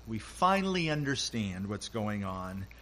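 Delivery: ordinary delivery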